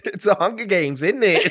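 high-pitched mock British, through laughter; bad British accent. Co-host joking about Hunger Games in bad British accent. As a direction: ham up a British accent badly. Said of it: "I can't do a British accent".

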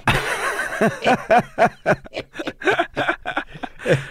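airy, breathy burst of laughter; long laugh. Real, not acted. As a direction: laugh out hard at the joke. Laughs at the joke.

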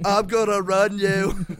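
hammed-up Forrest Gump voice; bad Forrest Gump impression. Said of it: "a bad Forrest Gump impression".

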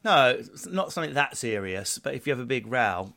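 ordinary talk

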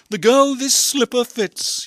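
storybook prince's proclamation; crying out. A narrator's reading. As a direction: proclaim it high and loud. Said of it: "he cried".

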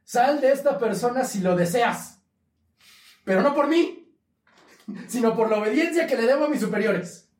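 quoting the saint casting out a demon; solemn exorcism quote. Retold or imitated. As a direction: intone it like a humble exorcist. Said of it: "lo voy a citar textual".

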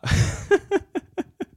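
a sudden high burst of laughter. Real, not acted. Comic laughing at his own story, high laugh.

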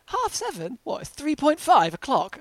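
high, loud, confused squeal of disbelief; mock the friends' total bewilderment; baffled disbelief. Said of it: "And they're like".

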